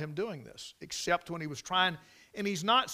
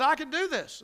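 eager boast; 12 semitones higher than usual. Retold or imitated. Retold David boasting eagerly.

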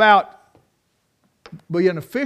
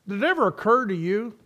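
ordinary talk

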